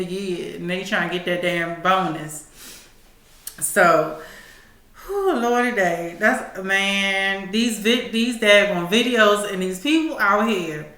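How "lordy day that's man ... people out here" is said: ordinary talk, nothing marked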